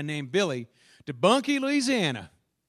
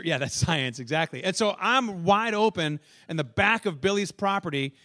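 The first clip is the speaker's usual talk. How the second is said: ordinary delivery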